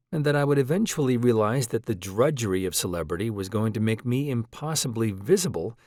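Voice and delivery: plain reading voice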